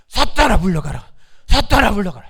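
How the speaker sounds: loud, forceful shout; commanding rebuke. Shown as a demonstration. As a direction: shout down the devil. Preacher repeats rebuke. (사탄을 쫓는 힘찬 외침)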